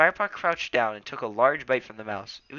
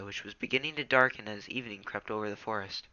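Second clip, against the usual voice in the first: ordinary delivery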